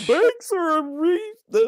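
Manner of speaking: very high, slow imitation; high mimicked character voice; put-on show voice. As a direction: draw it out in a high cartoon voice. Imitates show's voices after mention.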